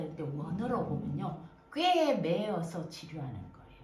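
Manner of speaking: ordinary talk, nothing marked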